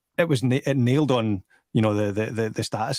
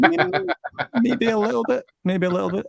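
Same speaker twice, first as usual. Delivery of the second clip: laughing; 11 semitones higher than usual. Real, not acted. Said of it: "I'm putting on my telephone voice just now".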